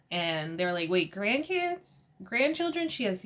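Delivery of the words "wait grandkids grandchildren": high, drawn-out incredulous questions; mock disbelief. Guests react to Mia having grandkids, mock disbelief. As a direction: echo it back higher, as if you misheard.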